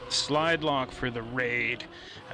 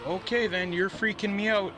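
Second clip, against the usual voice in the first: higher, uneasy, rising alarm; nervous alarm; 8 semitones higher than usual. Retold or imitated. Storyteller to silent neighbour at the door, spooked and higher. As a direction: sound spooked and on edge.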